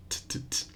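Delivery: "t, t, t" as breathy puffs with no voice; voiceless t demo. Shown as a demonstration. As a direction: tap out "t" with no voice at all.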